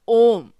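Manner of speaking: ओम with the vowel held long; pluta vowel. Shown as a demonstration. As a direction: stretch the ओ in ओम three times as long. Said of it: "जैसे". (प्लुत स्वर में लंबा खिंचा ओम)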